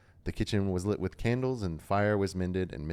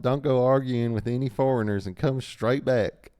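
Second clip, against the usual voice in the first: put-on southern accent; 6 semitones higher, 7 dB louder than usual. A narrator's reading. Farmer's wife warning her husband, in a southern voice. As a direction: scold fondly in a big southern voice, pitched up. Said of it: "I'm gonna do Farmer Maggot in a southern voice".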